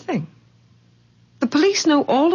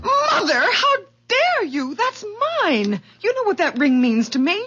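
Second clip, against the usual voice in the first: much higher, crying angry shout; furious outburst; 8 semitones higher than usual. Acted. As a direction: lash out at Mother, voice cracking with hurt. Daughter confronts mother over ring.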